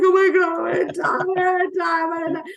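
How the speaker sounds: mock panic. Presenter to herself after hearing nothing, high mock panic. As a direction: squeal it like you're dying of embarrassment.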